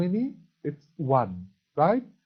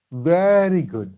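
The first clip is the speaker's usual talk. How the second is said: loud, drawn-out praise; emphatic praise; 4 semitones higher, 8 dB louder, much slower than usual. Real, not acted. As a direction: stretch it out loudly to praise the class. Teacher praising the pupils' answer.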